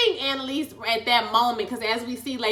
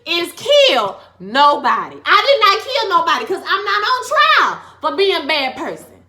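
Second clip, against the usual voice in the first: high, loud courtroom defense; pleading innocence like a defendant on the stand; impassioned loud plea; 8 semitones higher, 9 dB louder than usual. Acted. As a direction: plead your case to the jury at full volume. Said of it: "Okay, I know I got real loud".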